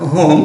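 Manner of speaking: whom said as hum, w silent; correct pronunciation. Shown as a demonstration. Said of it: "ও থাকলে সেটা হবে হু". (whom, হুম উচ্চারণ, ডাব্লিউ নীরব)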